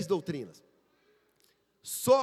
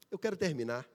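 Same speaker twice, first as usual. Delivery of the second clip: ordinary delivery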